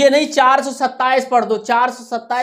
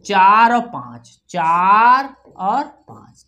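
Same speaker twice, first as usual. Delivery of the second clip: loud, slow, stretched digits; dictation; 5 dB louder, much slower than usual. Shown as a demonstration. Teacher dictating decimal digits to children, slow dictation. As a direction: call out each digit slowly and firmly.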